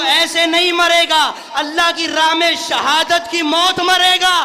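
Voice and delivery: loud, high, driving pulpit oratory; full-force preaching. Retold or imitated. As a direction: hammer each phrase, building to a shouted climax.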